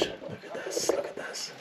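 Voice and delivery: awed whisper. Real, not acted. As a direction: breathe it in wonder. Awe inside temple.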